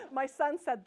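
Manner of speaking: normal talking voice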